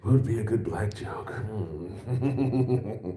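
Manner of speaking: musing whisper; a hushed, plotting little hum. Retold or imitated. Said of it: "You're like".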